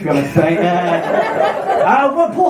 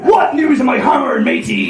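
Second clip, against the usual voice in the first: Captain Birdseye; 5 semitones higher than usual. Acted. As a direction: call out like a hammy sea captain. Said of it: "I sound like Captain Birdseye".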